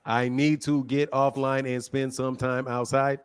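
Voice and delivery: low, even parroting of the caller; mocking robot monotone. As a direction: echo the caller like a toneless robot. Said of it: "You speak like a robot".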